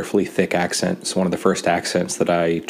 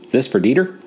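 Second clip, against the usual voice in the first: knowing question; 6 semitones higher, 4 dB louder than usual. Retold or imitated. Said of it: "she just says".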